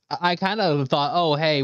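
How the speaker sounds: usual speaking voice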